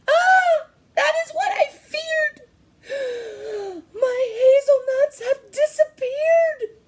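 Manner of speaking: very high, loud shrieking squirrel with a gasp. Acted. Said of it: "The voice shrieked".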